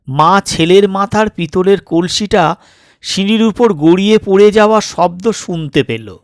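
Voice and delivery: ordinary delivery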